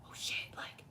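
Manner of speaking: startled, hushed whisper between spirits; whispering spirit guides. Retold or imitated. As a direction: whisper it like caught eavesdroppers. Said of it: "overhearing my spirit guides talk".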